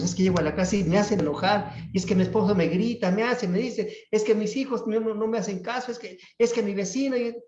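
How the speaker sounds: high, rapid litany of excuses; mock complaining. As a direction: rattle off grievances in a high-pitched imitation. Acts out people blaming others. (queja imitada, aguda y atropellada)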